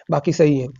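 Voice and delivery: usual speaking voice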